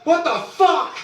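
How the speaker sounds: high, loud disbelieving yelp; shocked outburst. Real, not acted. Startled by something on stream, shocked high outburst. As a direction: blurt it out in shock.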